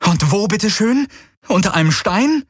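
high, indignant questioning; sarkastisch. Acted. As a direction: fire back incredulous questions, pitched high. Kirai spottet über den Rat. (hohe, spöttisch empörte Rückfrage)

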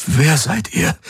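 high, startled question; frightened. A narrator's reading. Disoriented man facing two strangers, frightened. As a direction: ask in a high, alarmed voice. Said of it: "Er blinzelte erschrocken".

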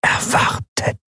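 very slow, voiceless mask whisper; ghostly whisper. A narrator's reading. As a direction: let the words drift like cold fingers. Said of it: "die Flüsterstimme war die gleiche". (gespenstisches, langsames Flüstern)

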